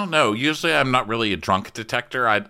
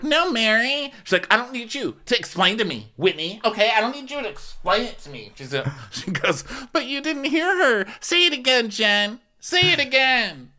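much higher sing-song mock voice; mocking Whitney; 11 semitones higher than usual. Podcaster mimicking Whitney correcting Mary, high mocking voice. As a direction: play the tipsy friend, pitched way up.

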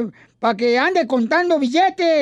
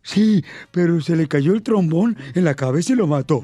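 put-on dopey kid voice; 8 semitones lower than usual. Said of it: "Dice el Babalucas".

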